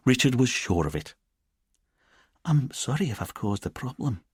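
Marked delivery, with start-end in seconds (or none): 2.5-4.2 higher, soft-edged Edinburgh lilt; lilting Edinburgh accent. Acted. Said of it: "in her lilting Edinburgh accent".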